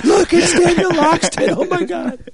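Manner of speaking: high squealing fan gush; starstruck fan. Pretend.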